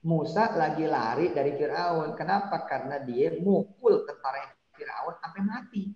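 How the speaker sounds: ordinary delivery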